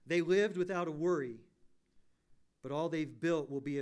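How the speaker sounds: loud, rising, impassioned pulpit voice; preaching at full force. Acted. Pastor warning his church about empty lives, preaching loud and impassioned. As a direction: drive it home to the congregation.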